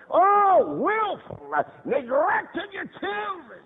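loud, high lamenting cry; impassioned preaching. Real, not acted. Preacher rebuking parents for willful neglect, high loud cry. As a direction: wail the charge at the congregation.

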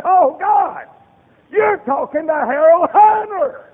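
high, loud boastful pleading to God; mocking his proud younger self. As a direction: shout his own arrogance back at God. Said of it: "I said".

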